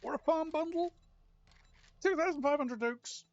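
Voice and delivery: rising high excitement; building excited repeat. Real, not acted. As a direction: gloat over the number. Excited about the loot.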